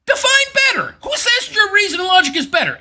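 high, shouted challenge; exasperated high-pitched objection; incredulous heckling. Real, not acted. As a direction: demand it in a high, indignant yell. Heckling the debater's claim.

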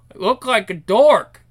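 talking through a mouthful; mouth full. Acted. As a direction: say it around a bite of food. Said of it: "Don't talk with your mouth full".

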